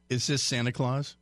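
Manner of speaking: ordinary talk, nothing marked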